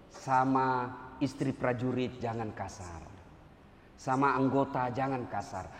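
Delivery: low, quiet and slow appeal; calm her down gently; soft pleading. Acted. Preacher asks officer's wife not to be harsh, soft and slow.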